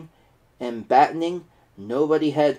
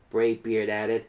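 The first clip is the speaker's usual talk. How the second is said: ordinary delivery, nothing marked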